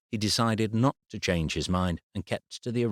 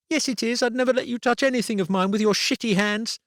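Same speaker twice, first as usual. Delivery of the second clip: put-on high child's voice, loud and spiteful; high-pitched angry child; snarling girl; 4 dB louder, faster than usual. A narrator's reading. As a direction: snarl it at him like a furious little girl. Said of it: "Abby snarled".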